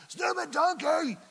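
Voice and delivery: high, loud exasperated shout at the donkey; angry yell. Acted. Balaam yelling in anger at his stubborn donkey. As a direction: yell it like a fed-up rider.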